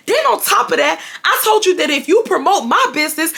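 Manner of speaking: angry ex ranting on the phone, mocked high; imitating mad ex. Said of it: "he just getting so mad".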